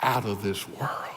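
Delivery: whispered awe. Acted. Preacher to congregation, awed whisper. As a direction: breathe it out in wonder. Hushed sermon close.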